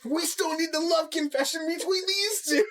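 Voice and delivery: high-pitched laughing protest; mock protest. Fan protesting about the missing love confession, high mock protest. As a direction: plead it high, half laughing. Acted protest at the plot twist.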